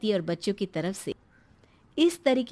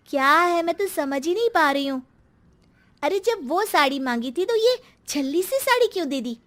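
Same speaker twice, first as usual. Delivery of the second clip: higher, whining rebuke of mother; angry daughter; 7 semitones higher, 5 dB louder than usual. Acted. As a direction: blame the mother, hurt and humiliated. बेटी college से नाराज़ लौटती है. (बेइज़्ज़ती से भड़की बेटी की शिकायत)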